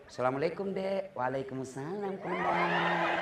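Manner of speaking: soft, lilting, drawn-out voice; a wife cooing to the commander on the phone; mock sultry phone greeting. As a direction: croon it like a flirting wife. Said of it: "jangan mendayu-dayu".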